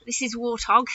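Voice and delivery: ordinary talk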